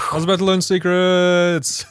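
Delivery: high, slow, drawn-out lament; mock wail. Playing the girl pulled away from secrets, mock wail. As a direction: wail it slowly, hamming up the frustration. Listener joins as the thwarted girl.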